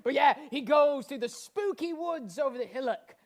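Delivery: ordinary delivery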